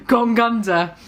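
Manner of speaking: giggling through the line; breaking up while reading; laughing. Real, not acted. Reader laughing at the story's email request.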